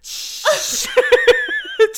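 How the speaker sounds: high squeaky mouth noise to mask the silence; covering mouth noise. Retold or imitated. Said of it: "ağzından şöyle bir ses yapıyorum".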